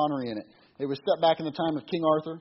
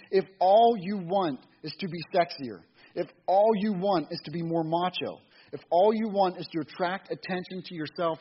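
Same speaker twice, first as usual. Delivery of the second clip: reading aloud; 3 semitones higher than usual. Acted. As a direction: read the book to the congregation with conviction.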